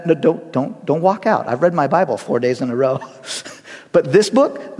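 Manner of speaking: usual speaking voice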